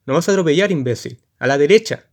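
insulto gritado, alto; grito furioso. Acted. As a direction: grita con rabia el insulto. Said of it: "grita otro cochero invisible con cólera". (otro cochero le grita furioso a Jonah)

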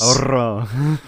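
"Orro" with a long trilled R; rolled r. Shown as a demonstration. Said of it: "I like to do that".

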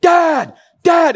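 put-on high, loud boy calling across a field; imitated child's yell. Boy calling back to dad at a football game, high imitated shout. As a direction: mimic a kid hollering for his father. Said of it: "he'd turn back and go".

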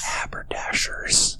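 breathy stage whisper. Acted. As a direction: hiss the word in a whisper. Whispered word, sketch.

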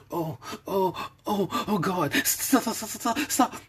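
high panting cries; woman panting, overwhelmed; panted out. A narrator's reading. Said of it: "she panted out".

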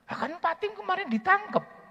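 very high, loud exclamation; blurting out the news; exclaiming colleague. Retold or imitated. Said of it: "teman-teman ngomong begini". (teman berseru sangat tinggi)

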